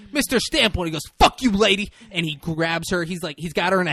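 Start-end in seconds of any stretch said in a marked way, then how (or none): 0.1-0.9 loud, high courtroom reprimand; judge calling out. Acted. Judge calling out during courtroom outburst. 1.2-1.9 loud, high-pitched insult; acted screaming. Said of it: "he's just fucking screaming".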